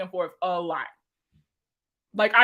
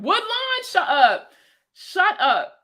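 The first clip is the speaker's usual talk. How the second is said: excited disbelief; 10 semitones higher, 4 dB louder, much slower than usual. Real, not acted. Hearing a chat member's old school.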